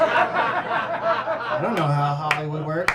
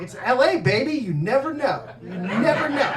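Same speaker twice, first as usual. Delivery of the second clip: loud, higher hype-man exclamation; breezy Hollywood hype; 4 semitones higher, 5 dB louder than usual. Retold or imitated. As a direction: act out an over-confident LA pep talk. Acted upbeat reply to his doubts.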